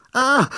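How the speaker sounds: sudden high loud scream; short high scream. A narrator's reading. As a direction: cry out in shock. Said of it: "konnte Turilda Josefina gerade noch schreien".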